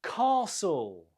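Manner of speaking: "Castle" with a long ah, stressed on the first syllable; long vowel, first-syllable stress. Shown as a demonstration. Teacher models the word, long stressed ah in "Castle". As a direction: draw out the ah in "Castle", silent t. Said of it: "we have a long vowel sound".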